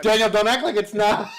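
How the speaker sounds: high teasing laugh. Real, not acted. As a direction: tease while laughing.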